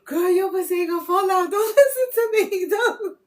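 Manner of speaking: can barely get it out for laughing; laughing through words. Real, not acted. Laughing while she talks.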